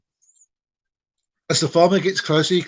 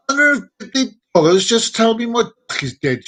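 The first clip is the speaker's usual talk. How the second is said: high-pitched comic outrage; indignant shriek; 7 semitones higher, 4 dB louder than usual. Acted. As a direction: protest shrilly as a shocked customer. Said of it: "she shrieks with indignity".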